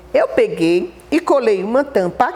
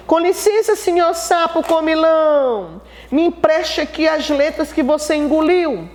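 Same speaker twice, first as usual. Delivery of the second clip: higher-pitched mock-polite plea; playful, higher storytelling voice; polite request to toad; 5 semitones higher than usual. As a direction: sing out a courteous request to a toad.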